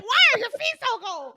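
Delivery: exaggerated pained disbelief; startled complaint. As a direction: blurt it as a partner hit by icy toes. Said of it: "He was just like".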